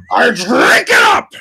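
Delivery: hammed-up screaming villain; shouted movie-quote voice. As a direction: bellow the milkshake line like a madman. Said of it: "doing all these voices".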